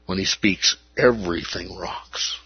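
everything rocks, breathed softly; awed whisper; hushed whisper. Acted. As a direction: whisper it in awe. Whispered awe in sermon.

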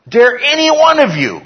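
high, loud cry of indignation; scandalised shout, falling at the end; emphatic outrage. Acted. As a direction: challenge the whole congregation at a shout. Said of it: "He is really emphatic about this".

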